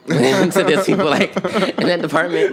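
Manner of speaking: high, loud, laughing admission; laughing self-mockery. Real, not acted. Student joking about their standing in the department, laughing loudly. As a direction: burst out laughing at yourself.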